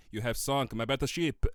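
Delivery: mock European accent with a mangled word; faux European accent. Imitating Death's silly European accent for his co-host. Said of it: "This is how he, he speaks in the movie".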